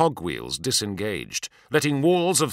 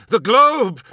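loud, high warning shout; 12 semitones higher, 9 dB louder than usual. A narrator's reading. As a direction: yell the warning. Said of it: "shouted Voyen".

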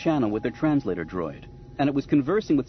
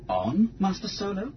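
higher, questioning droid voice; puzzled droid; 7 semitones higher than usual. Acted. C-3PO asking Han what he means, puzzled droid. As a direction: ask it as a bewildered protocol droid.

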